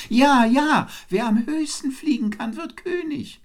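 very high, excited bird voice; twittering swift. A narrator's reading. Swift agrees at once with the wren, twittering high. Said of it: "zwitscherte der Mauersegler".

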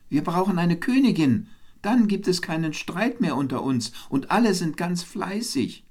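high, animated pitch of an idea; eager calling. A narrator's reading. Wren proposes a queen to the birds, eagerly calling. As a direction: win them over in a high, eager voice. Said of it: "rief er".

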